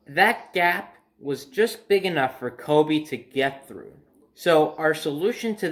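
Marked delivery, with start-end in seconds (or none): none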